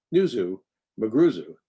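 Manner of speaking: usual speaking voice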